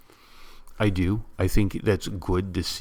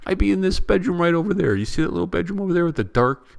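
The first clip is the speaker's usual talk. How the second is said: much higher, exasperated outburst; indignant rant; 10 semitones higher, 3 dB louder than usual. Real, not acted. Vlogger ranting at trolls who say he does it for views, pitched high and exasperated. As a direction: fire back at the trolls, voice climbing high.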